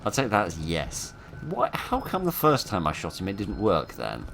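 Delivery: usual speaking voice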